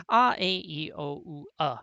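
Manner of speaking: A, E, I, O, U as pure vowel sounds, then "uh"; vowel sounds recited. Shown as a demonstration. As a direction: list each vowel sound separately, ending on a schwa. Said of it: "six-vowel system consisting of".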